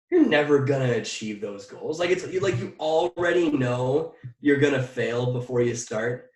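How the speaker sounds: ordinary talk, nothing marked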